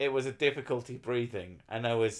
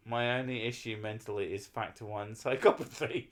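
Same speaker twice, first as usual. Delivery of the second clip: ordinary talk, nothing marked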